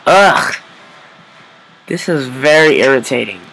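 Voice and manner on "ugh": loud annoyed ugh; exasperated grunt; frustrated groan. Real, not acted. Frustrated after dying.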